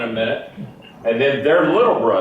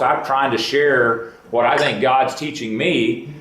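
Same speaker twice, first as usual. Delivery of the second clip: usual speaking voice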